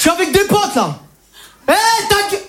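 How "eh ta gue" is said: higher fake-tough snap that breaks off; mock hard man faltering; tough guy. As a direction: start the insult then bottle it. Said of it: "Je vais vous montrer".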